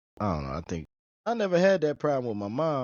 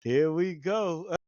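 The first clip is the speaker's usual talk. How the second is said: slow resigned; 3 semitones higher, much slower than usual. Real, not acted. Bracing for trouble.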